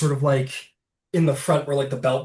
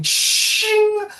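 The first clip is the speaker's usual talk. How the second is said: blade ring; 5 dB louder than usual. Acted. Blades drawn, sound effect.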